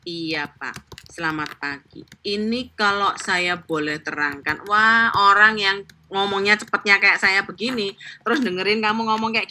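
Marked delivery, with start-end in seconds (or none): 0.0-4.6 soft, slow, overly polite client greeting; slow patient sales voice. Shown as a demonstration. Salesperson greeting a fast-talking client, slow and soft. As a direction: drag it out politely. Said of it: "Jangan, meskipun kamu sabar ya".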